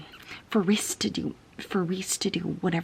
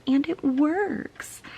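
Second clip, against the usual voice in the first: mocking author voice; 9 semitones higher, much slower than usual.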